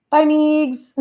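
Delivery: sing-song farewell to a friend; teasing goodbye. Acted.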